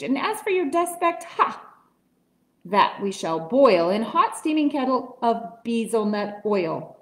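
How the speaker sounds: plain reading voice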